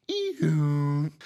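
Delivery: high, held electronic whine; imitated power-down noise. Pretending to unplug the electronics, imitated power-down whine. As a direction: mimic a machine being unplugged.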